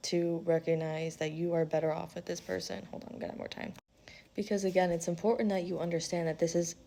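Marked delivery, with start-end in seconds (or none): 2.9-3.7 hushed aside, off to the side; whispered aside. Real, not acted. Pausing mid-reading to whisper hold on. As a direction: drop to a whisper for a quick aside.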